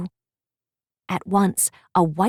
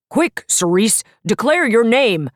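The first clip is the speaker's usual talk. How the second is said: louder urgent professor bear; a teacher bear calling urgently; shouting; 3 semitones higher, 4 dB louder than usual. Acted. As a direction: urge her quickly, raising the voice. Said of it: "shouted Professor Papa Bear".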